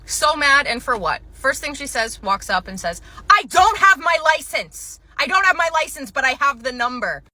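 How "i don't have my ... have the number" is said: angry customer. Retold or imitated. Angry customer voiced in story.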